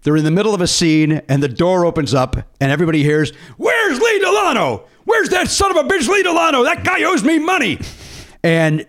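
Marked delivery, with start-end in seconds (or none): 3.6-7.8 mock angry yell. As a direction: storm onto the set yelling for him. Mel barges onto set shouting.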